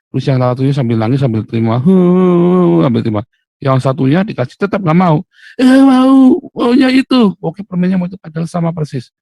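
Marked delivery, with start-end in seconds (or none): 1.8-2.8 put-on a sharp, high, loud huff like a pouting child; crying kid's indignant huff; sulky child's huff. Child sulking over candy. 5.6-7.3 put-on much higher, louder whining kid; little kid insisting on the other candy; whiny child. Sulking child refusing the candy.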